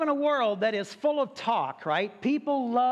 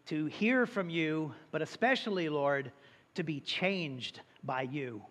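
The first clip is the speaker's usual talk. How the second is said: soft, earnest prayer voice, quieter than his preaching; 4 semitones lower, 3 dB quieter than usual. Acted. Pastor opening the service in prayer, soft and reverent. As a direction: pray it gently and reverently to God.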